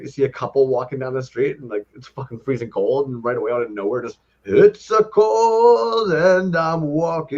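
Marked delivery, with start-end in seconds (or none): 4.4-7.4 much higher, very slow, put-on narrating voice; drawn-out narration of passersby, lightly hammed; mock narrator. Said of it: "you're narrating everything".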